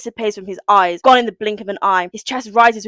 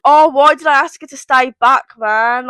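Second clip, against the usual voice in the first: loud, raised lament; mock anguish; 4 semitones higher, 6 dB louder than usual. [name] regretting he kept her back, mock anguish. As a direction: wail with hammed-up regret.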